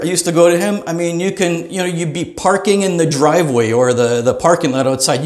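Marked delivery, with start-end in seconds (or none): none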